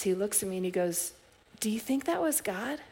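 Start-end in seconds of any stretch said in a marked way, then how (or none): 1.6-2.8 a higher, searching "Do you think that was God?" with the tune rising on "that"; first take lifts "that", high and wondering; rising earnest question. Retold or imitated. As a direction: ask it wide-eyed, lifting at the end. Man asks earnestly, first take.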